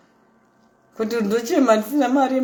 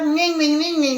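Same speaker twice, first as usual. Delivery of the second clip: mock English gabble; 7 semitones higher than usual. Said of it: "in English".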